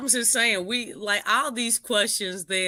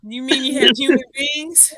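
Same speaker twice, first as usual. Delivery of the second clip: laughing; 4 semitones higher, 6 dB louder than usual. Real, not acted. Mocking the organic roster.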